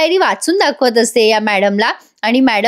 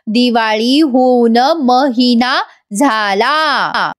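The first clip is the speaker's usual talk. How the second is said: slow, halting recital; struggling to speak; much slower than usual. Retold or imitated. Madam repeating after Gauri, slow and laboured. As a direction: drag each word out with effort. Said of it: "थोडं बोलायला त्रास होतोय".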